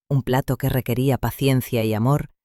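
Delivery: usual speaking voice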